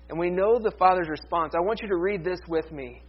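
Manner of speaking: ordinary talk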